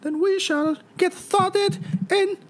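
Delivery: very high, stretched put-on voice; high, drawn-out character voice. Co-host counting down to start the show, in a very high put-on voice.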